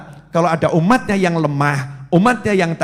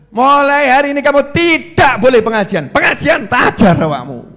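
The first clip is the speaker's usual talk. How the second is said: higher, louder husband barking a ban; angry order; 7 semitones higher, 5 dB louder than usual. Retold or imitated. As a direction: forbid her the study group with a loud shout. Husband shouts at wife. (suami membentak dengan keras)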